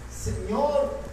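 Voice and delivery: súplica suave y más baja; un "Señor, socórreme" casi susurrado; suave y sutil. Acted. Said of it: "fueron sutiles, fueron suaves". (ruego suave y tierno, sin fuerza)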